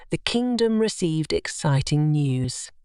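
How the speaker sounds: usual speaking voice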